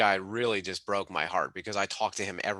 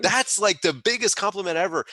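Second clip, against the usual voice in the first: laughing delight; 10 semitones higher, 5 dB louder than usual. Real, not acted. Gushing about mentees succeeding.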